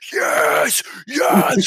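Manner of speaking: high-pitched celebratory shout; reenacted goal-celebration yell; retold screaming cheer. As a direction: scream it like your team just scored. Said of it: "screaming at the fucking top of my fucking lungs".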